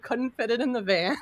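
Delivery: normal talking voice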